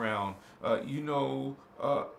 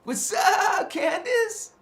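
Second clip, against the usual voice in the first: loud, much higher shout-out; excited shout-out; 8 dB louder, slower than usual. Real, not acted. Host greeting a viewer by name, loud excited shout-out. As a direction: call out a big hello to a friend.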